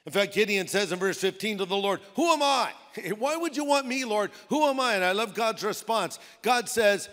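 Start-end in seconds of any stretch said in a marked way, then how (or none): 2.2-5.0 high, raised-voice disbelief; incredulous protest. Acted. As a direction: question it as if wholly unworthy. Gideon protesting his calling to God.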